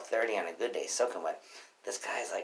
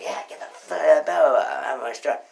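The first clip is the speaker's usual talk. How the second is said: loud, high, drawn-out order; retold angry shout; 5 semitones higher, 9 dB louder, slower than usual. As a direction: bellow him out, slow and loud.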